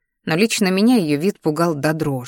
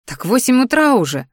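higher-pitched exclamation; exclaiming; 6 semitones higher, 3 dB louder than usual. A narrator's reading. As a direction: throw it out in mild dismay. Roommate exclaims at oversleeping friend.

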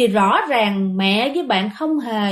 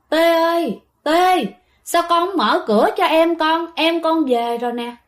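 higher, louder call from outside the door; retold ghostly father's summons, voice raised; 5 semitones higher, 3 dB louder than usual. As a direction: call to a daughter to open up. Dead father calls daughter from outside.

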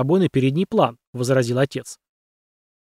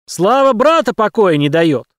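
loud, slow, higher yell; 6 semitones higher, 6 dB louder, slower than usual. A narrator's reading. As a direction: roar it at the cadets. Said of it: "заорал Уразаев".

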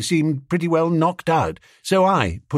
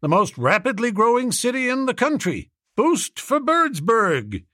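rally them with a high, proud town slogan; booster businessman; 10 semitones higher than usual. A narrator's reading.

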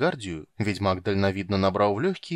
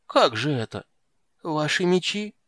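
nervous clerk voice; 5 semitones higher, 3 dB louder than usual. A narrator's reading. Clerk Hanshorik panics.

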